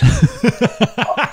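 high, giddy burst of laughter. Real, not acted. Recounting first trail race to a friend, giddy high laugh. Stunned that walking hills is allowed.

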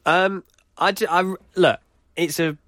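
usual speaking voice

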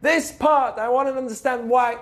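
high-pitched, stubborn demanding; insistent fool. Acted. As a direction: play a fool fixated on one detail. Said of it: "What does the fool say?".

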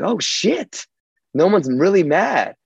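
high, bursting with relief; relieved excitement. Retold or imitated. Relief after family's reaction.

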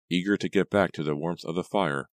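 plain reading voice

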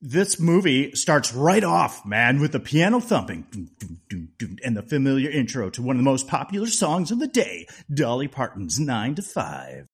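punchy, hyped-up radio DJ intro. Pretend. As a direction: announce it like a DJ introducing a hit. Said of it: "Trying to do my radio D J voice".

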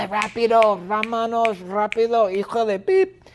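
high-pitched, impatient hurry-up yell; urgent, high character shouting. Acted. Impatient character yelling, voiced.

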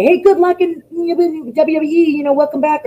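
high-pitched well-wishing parents; high, singled-out send-off voice; imitating parents. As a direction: mimic cheering parents in a high voice. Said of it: "I thought they would say".

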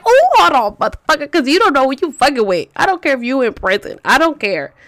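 high, loud retort easing down; hella mad. Retold or imitated. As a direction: burst in indignantly, then settle into defiance. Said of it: "like, hella mad".

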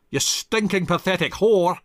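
high, loud husband hurling an insult; angry insult. Acted. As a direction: hurl it back at her. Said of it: "he said in return".

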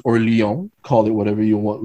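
ordinary delivery

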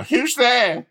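a hammed-up frightened squeak at the door; mock scared call. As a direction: squeak it like a scared kid hiding. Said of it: "And you'll go".

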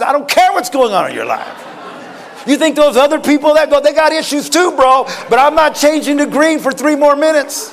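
loud, high, unsympathetic comeback; talking red light. Acted. Red light refusing the hurried driver, loud and indignant. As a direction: shut the driver down with mock indignation. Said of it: "Red light says".

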